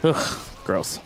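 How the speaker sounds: muttered disgusted ugh; breathy, grossed-out grumble under the breath; annoyed ugh. Real, not acted. Bad pull in dungeon run.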